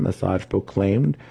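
ordinary delivery, nothing marked

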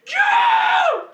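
loud excited shout. Acted. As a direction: yell it out high. Shouted game show style quiz.

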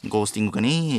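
a hint of a mocked streaming voice; Seiya's mimicry. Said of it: "配信の時の俺をモノマネするせいやの言い方すな".

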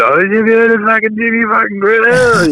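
high, hammed-up mock announcer voice; mock his own cringey fight-night hype. Fighter mocking his old post-fight hype, in a high hammed-up voice. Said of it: "I was".

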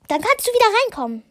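rattled off fast and high; quick high call. Acted. Harry calls after Ginny as she leaves, quick high call.

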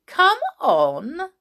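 high, loud swooping "come on"; jeering invitation; mocking. A narrator's reading. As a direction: egg them on teasingly. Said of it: "mocked the two boys".

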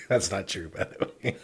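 cracking up while objecting; laughing protest. Real, not acted. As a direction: protest through a burst of laughter. Protesting through laughter at teasing.

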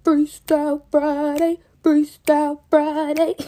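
high, loud, slow stretched-out welcome; hyped greeting. Real, not acted. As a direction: stretch out each word like a hype host. Hyped show greeting to listeners.

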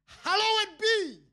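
drawn-out high proclaiming; proclaimed prayer. Acted. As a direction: lift it up like a sung proclamation. Quoting prayer, voice of saints.

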